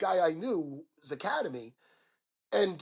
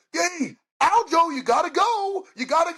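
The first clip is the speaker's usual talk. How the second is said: high, drawn-out call to a fighter; imitated yelling; 9 semitones higher, 3 dB louder than usual. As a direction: holler it out as if across an arena.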